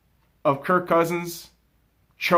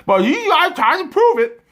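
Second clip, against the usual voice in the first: 1 mocking Booger; 5 semitones higher, 4 dB louder than usual. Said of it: "he kept fucking telling everybody".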